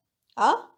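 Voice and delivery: "啊" with a steep questioning rise; asking for follow-up. Shown as a demonstration. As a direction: lift the pitch of "啊" to ask. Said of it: "expressing opinion or asking".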